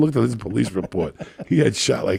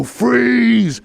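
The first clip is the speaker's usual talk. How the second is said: high, loud barked freeze; mock police shout bursting through the door; shouted command; 12 semitones higher, 5 dB louder than usual. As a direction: startle the room with one yell. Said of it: "and he'd go".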